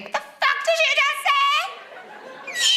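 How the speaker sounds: very high, slow screech; hammed-up furious shriek; mock outrage. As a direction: screech it like picking a fight. Acted girl picking a fight.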